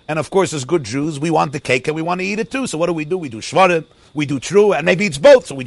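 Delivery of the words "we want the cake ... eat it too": normal talking voice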